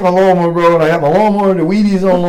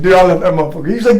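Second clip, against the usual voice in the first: normal talking voice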